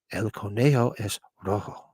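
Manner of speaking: low, hushed Spanish little voice; whispering little voice. Retold or imitated. Host quoting the film's little voice in Spanish, hushed. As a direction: imitate a small creepy whispered voice, softly. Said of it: "a little voice kept going".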